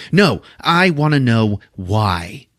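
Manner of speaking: slow, drawn-out, stubborn insistence; mock demanding; insistent demand. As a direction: refuse to drop it, stretching the words. Acted insistence on an answer.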